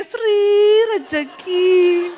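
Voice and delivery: very slow, stretched "Rejeki"; drawn-out name. Acted.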